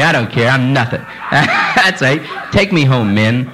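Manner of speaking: usual speaking voice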